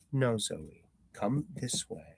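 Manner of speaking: plain reading voice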